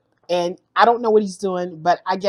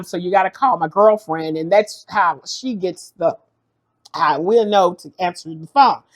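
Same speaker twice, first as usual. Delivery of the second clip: usual speaking voice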